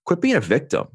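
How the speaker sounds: stern cellmate. Retold or imitated. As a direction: snap it at him like a tough mentor. Cellmate confronting him in cell.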